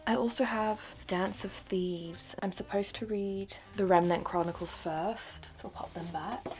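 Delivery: ordinary talk, nothing marked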